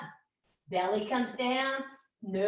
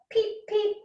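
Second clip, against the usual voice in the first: high squeaky peep peep; chick peep; 12 semitones higher, 4 dB louder than usual. Pretend. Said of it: "make a beep".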